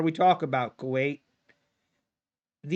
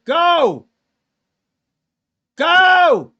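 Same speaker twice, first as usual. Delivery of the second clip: high, loud cheering; frantic cheer for the price; excited shout; 11 semitones higher, 12 dB louder than usual. Real, not acted. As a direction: yell it on like a race. Cheering market upward.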